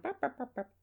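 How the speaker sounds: high, soft nonsense babble; imitated background chatter. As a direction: mimic muffled parents with repeated little noises. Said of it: "you can hear his parents in the background".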